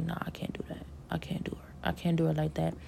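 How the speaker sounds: half-whispered, very soft refusal; hushed refusal. Retold or imitated. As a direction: murmur it, voice almost gone. Retells own low refusal.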